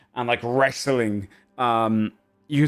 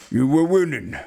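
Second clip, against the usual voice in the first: ogre voice, slightly raised; lightly voiced big ogre; bigger ogre voice; 4 semitones higher, 3 dB louder than usual. Bigger ogre to his brother after the fight, lightly put-on voice. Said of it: "The bigger one goes".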